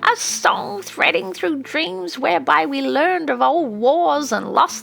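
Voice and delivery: put-on an old witch passionately defending magic and sending them off; fierce old witch.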